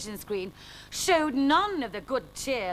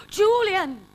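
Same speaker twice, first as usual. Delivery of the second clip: much higher, loud cry; crying out a name; 10 semitones higher, 10 dB louder than usual. Acted. Jasper recognising Julian, a loud cry. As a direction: shout his name in shock. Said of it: "cried Jasper".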